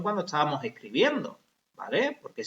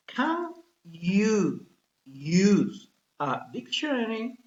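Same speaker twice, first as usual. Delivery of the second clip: slow model question; 3 semitones higher, much slower than usual. Shown as a demonstration. Teacher models question slowly.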